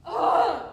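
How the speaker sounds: loud, high exasperated "ugh"; disgusted groan. Acted. Girl fed up with the boy, exasperated groan. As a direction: let out a loud groan of loathing. Opening of hate monologue.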